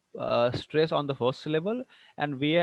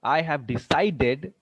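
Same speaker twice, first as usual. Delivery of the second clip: "decided" slowed, leaning on "ci"; stress on second syllable; slower than usual. Shown as a demonstration. Teacher showing verb stress, slow "decided" stressed on CI. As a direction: draw out "decided" as de-CI-ded. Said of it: "see the stress".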